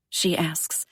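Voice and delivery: ordinary delivery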